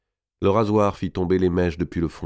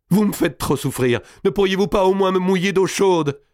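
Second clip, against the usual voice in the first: high, pleading cry of suffering; pained outcry; 13 semitones higher, 4 dB louder than usual. A narrator's reading. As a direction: cry out and beg for relief. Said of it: "Édouard s'écria". (cri de douleur suppliant)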